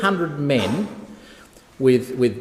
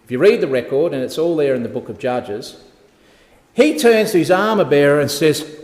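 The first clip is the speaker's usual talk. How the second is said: normal talking voice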